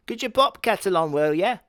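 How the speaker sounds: high, mock-British mum asking. Mum asking her son for tea, high and chirpy. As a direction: ask for the kettle in a high mum voice.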